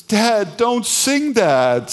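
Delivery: put-on high, loud child's plea, drawn out slowly; son's protest. Son in the car begging dad, high pleading. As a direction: squeak it out like a kid begging dad to stop. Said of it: "my son, Jake, goes".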